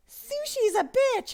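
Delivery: high, loud and drawn out, mocking the crowd; mock outraged shout. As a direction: yell it like an angry Twitter mob. Mocking what people tweet.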